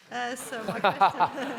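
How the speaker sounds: asking through a real laugh; a question that breaks into laughter. Real, not acted. Questioner laughs about working in insurance.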